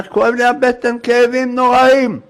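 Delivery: acted suffering; pained complaint. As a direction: wail about a sore stomach. Sufferer complains of terrible pains.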